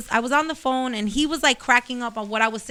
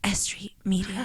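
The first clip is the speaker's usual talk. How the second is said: hushed, breathy channel name; whisper; 4 semitones lower, 8 dB quieter than usual. Shown as a demonstration. Co-host whispers the channel name for the stream, hushed. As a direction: whisper it close to the mic. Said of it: "[name], please say it".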